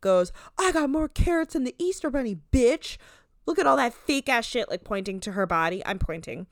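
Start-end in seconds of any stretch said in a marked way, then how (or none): 0.6-4.7 raised, high-pitched imitation of an insult; high, forceful mimicked yell; mock yelling. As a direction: hurl it like a retold comeback. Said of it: "Romance yells in some attempt".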